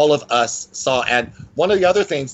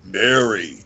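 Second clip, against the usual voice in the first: low-pitched man calling a name; lower, drawn-out male voice; deep man's voice; 4 semitones lower than usual. Retold or imitated. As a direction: drop low to imitate a man. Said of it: "very deep man's voice".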